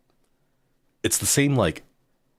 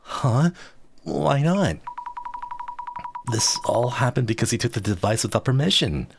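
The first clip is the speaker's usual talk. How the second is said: higher character voice; 7 semitones higher than usual. Acted.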